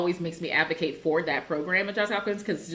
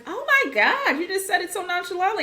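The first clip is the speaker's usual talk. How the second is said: high, loud, squealing disbelief; shocked labmates; 13 semitones higher, 3 dB louder than usual. Retold or imitated. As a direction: shriek it like stunned coworkers. Labmates shocked at wedding news.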